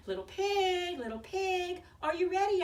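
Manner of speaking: high, loud calling wolf. Acted. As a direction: call up to the house. Wolf calling at pig's door.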